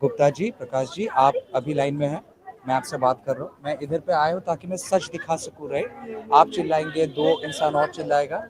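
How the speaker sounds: steady, soothing reporter voice; calming. Real, not acted. Calming an upset man in queue.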